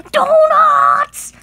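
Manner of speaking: high, loud gasped cry; thrilled toy spotting donuts; gasp of delight. Acted. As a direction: gasp then shout for joy. Sees donuts appear.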